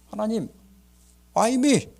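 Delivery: imitated indignant challenge, pitched high; protesting to God. Shipwrecked captain arguing with God, indignant protest. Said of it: "하고 따지는 거야".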